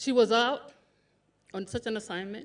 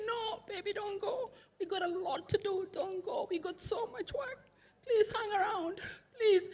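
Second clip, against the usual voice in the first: tearful pleading; 9 semitones higher, 8 dB quieter than usual. Retold or imitated. Sister begging dying husband to stay.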